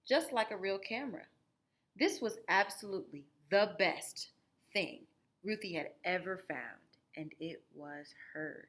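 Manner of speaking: plain reading voice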